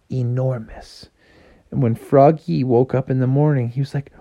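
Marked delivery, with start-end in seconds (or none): none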